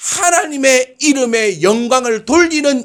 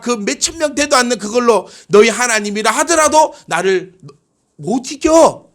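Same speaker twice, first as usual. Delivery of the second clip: high, forceful enemy boast; taunting Assyrian king; 5 semitones higher than usual. Acted. Enemy king flaunting his huge army, scornful taunt. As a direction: boast over a puny opponent. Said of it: "너희가 믿고 있는 하나님을 욕하는 거예요".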